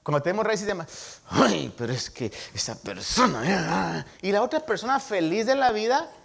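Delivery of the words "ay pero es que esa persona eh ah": strained, exaggerated groaning about someone; embittered complaint. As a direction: act out a bitter person agonizing over another. Acts out a person eaten by bitterness.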